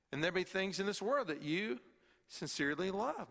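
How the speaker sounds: ordinary talk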